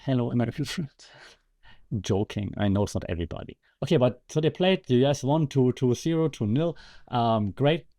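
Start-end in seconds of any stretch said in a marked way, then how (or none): none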